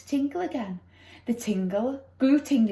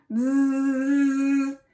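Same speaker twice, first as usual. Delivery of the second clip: doorbell buzz. Acted. Doorbell imitated.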